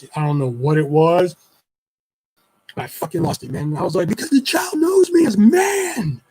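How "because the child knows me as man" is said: high, drawn-out anguished yell; screaming. Retold or imitated. Dad losing it at ex, screaming. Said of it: "I'm screaming in this house".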